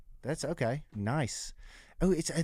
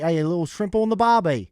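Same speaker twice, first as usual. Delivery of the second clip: louder, higher Australian barbecue cliché; Australian accent; 5 semitones higher, 7 dB louder than usual. Retold or imitated. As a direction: play it up like a beach Aussie. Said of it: "an Australian".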